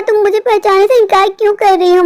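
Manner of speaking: ordinary talk, nothing marked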